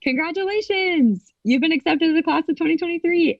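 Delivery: high ceremonial announcement voice; mock official email. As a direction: read the acceptance like a sing-song official letter. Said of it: "it was like".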